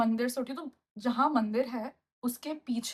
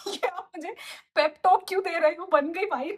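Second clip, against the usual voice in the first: louder, higher incredulous retort; exasperated; 5 semitones higher, 4 dB louder than usual. Retold or imitated. Daughter protesting to mom, exasperated and louder. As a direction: scoff at the pointless pep talk. Incredulous at mom's pep talk.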